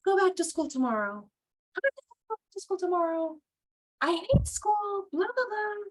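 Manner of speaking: mock sobbing child voice; whiny crying kid. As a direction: imitate a tearful child complaining. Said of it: "your kid comes from school crying".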